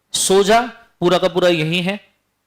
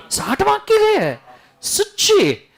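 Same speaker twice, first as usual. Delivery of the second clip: mock surprise. Mock student answer, teasing.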